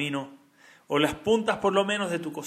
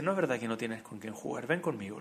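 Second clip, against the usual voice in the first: soft, lower consoling voice; tender reassurance; 4 semitones lower, 6 dB quieter than usual. Retold or imitated. Rabbi invites the lonely boy to come along, tenderly. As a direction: gently coax a lonely child along.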